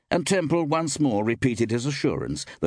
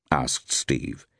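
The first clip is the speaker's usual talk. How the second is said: usual speaking voice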